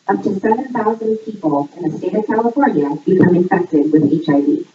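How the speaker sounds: normal talking voice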